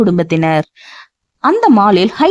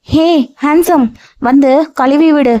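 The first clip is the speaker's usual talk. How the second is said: put-on childlike voice; 6 semitones higher than usual. A narrator's reading.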